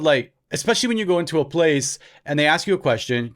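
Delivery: ordinary talk, nothing marked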